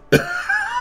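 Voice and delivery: short laugh up high; a burst of high laughter; high squealing laugh. Real, not acted. Laughing at the photographer story.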